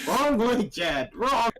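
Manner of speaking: high, loud exclamation; yelled correction. Acted. Player telling Chad he froze the wrong leg, yelling. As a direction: call out the mistake in a high yell.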